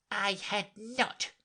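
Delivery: high, drawn-out indignant mouse; sharp and angry. A narrator's reading. Mouse contradicting Alice, sharply angry. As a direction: deny it angrily, slow and high. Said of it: "cried the mouse sharply and very angrily".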